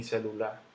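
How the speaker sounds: celular said celu-LÁ, stressed on the last syllable; wrong final stress. Shown as a demonstration. Teacher rejecting a wrong version, stress on final LA. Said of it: "ni celular".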